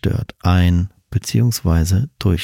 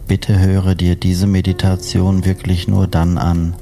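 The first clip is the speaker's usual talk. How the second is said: slow, calm sleep meditation voice; guided sleep meditation; slower than usual. Acted. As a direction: lull the listener toward sleep.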